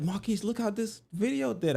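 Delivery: mom voice. Retold or imitated. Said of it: "She's like".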